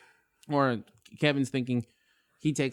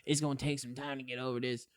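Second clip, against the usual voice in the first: sick friend; 2 semitones lower, 10 dB quieter than usual. Retold or imitated. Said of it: "He's like".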